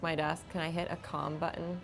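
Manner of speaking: usual speaking voice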